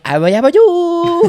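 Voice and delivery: high goofy cartoon voice; silly Jesus voice. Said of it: "My Jesus Christ goes".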